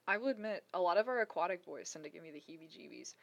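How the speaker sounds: ordinary talk, nothing marked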